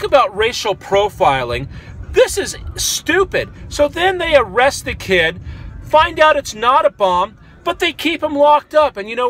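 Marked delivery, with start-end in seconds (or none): 2.1-3.5 higher and slow, THIS drawn out in disbelief; can't believe what he's hearing, This is stupid; slow, high exasperated This is stupid; incredulous disgust. Real, not acted. Disbelief at the arrest.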